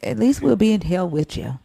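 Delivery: usual speaking voice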